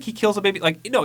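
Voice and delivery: normal talking voice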